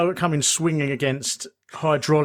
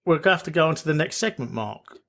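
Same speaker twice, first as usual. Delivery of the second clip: ordinary delivery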